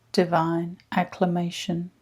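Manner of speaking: ordinary talk, nothing marked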